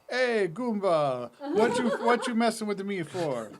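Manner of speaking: hammed-up Italian accent, slow start; mock Italian accent, high and jokey. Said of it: "whatever your Italian accent is".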